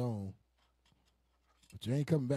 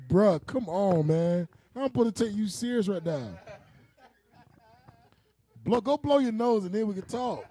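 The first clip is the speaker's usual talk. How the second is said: exasperated pleading; 4 semitones higher, 4 dB louder than usual. Acted. Husband pleading with crying wife.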